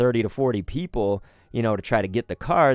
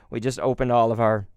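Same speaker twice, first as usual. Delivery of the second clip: ordinary talk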